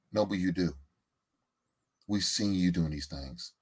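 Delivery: hushed, caring tone, like staging an intervention. Pretend. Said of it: "I don't like your intervention voice".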